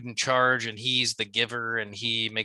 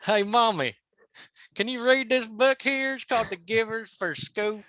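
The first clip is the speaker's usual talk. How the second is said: put-on much higher, slow childish pleading; high-pitched kid voice; 9 semitones higher than usual. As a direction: whine at mommy like a little kid. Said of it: "I didn't go as high pitched as you did".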